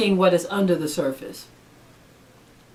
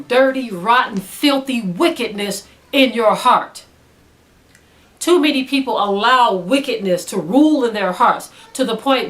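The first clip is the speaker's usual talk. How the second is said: louder, higher fervent preaching; preaching at full force; 3 semitones higher, 5 dB louder than usual. Acted. Preacher condemning those who cause depression, fervent. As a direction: preach it hard from the pulpit.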